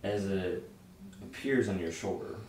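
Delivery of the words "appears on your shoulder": ordinary talk, nothing marked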